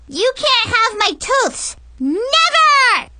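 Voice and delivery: put-on very high, loud little-girl defiance; brave kid shout. Little Brianna defies Mackenzie, shouting very high. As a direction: shout it like a brave tiny hero. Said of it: "Brianna shouted bravely".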